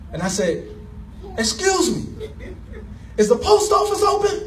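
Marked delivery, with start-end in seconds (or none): 1.4-4.4 calling out. Retold or imitated. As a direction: shout the question out the car window. Calling out from car window.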